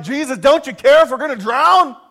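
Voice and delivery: loud, high mock-panicked cry; freaking out. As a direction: cry out like alarmed disciples, hammed-up. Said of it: "the disciples are freaking out".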